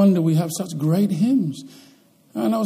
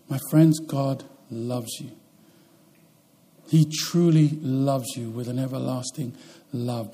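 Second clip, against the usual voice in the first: tender appeal; 4 semitones lower, 5 dB quieter than usual. Acted. Preacher telling his friends God loves them, softly. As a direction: speak it gently, like a promise.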